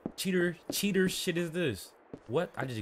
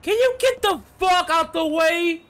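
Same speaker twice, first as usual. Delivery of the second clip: high, loud frustrated yell; angry yell; 7 dB louder than usual. Real, not acted. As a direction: shout at the car blocking you. Yelling at traffic in game.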